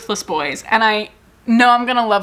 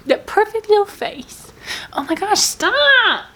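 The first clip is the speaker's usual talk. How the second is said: much higher, sing-song cooing; 10 semitones higher, slower than usual. Real, not acted. Greeting her pet Snoot, high cooing baby talk.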